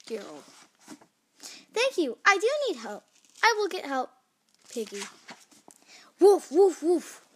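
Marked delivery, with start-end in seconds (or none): 1.7-4.1 high-pitched, eager little exclamations; eager Piggy. Acted. Piggy eagerly accepts help. 6.2-7.2 loud, high acted woofs; barking dog. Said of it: "Random dog".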